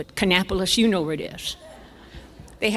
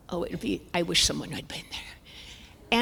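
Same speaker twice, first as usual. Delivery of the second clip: whisper; 3 semitones lower, 9 dB quieter than usual. Real, not acted. Wishing the audience had seen it, in a whisper. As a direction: whisper it wistfully.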